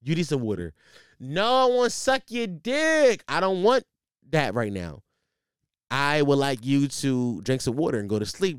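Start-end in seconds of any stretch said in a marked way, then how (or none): 1.2-3.2 put-on slow, higher, slurred drunk woman. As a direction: drag it out like a drunk girl begging. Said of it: "You're being very dramatic".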